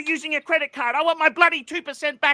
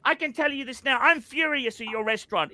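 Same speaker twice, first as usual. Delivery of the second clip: usual speaking voice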